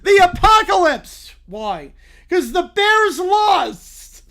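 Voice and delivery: high, loud, slow shouts; loud ranting. Acted. Little Brown Bear yelling why he ended the world, loud. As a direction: yell it out.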